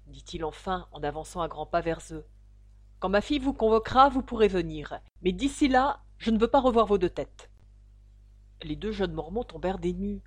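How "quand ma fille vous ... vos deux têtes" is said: angry father. Acted. Father throws out suitors.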